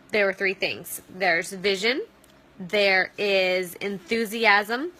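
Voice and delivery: ordinary talk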